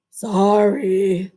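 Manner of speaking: low, drawn-out beaver apology; a sheepish beaver; beaver's sorry. Acted. Beaver apologizes to the girl, low. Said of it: "said Beaver".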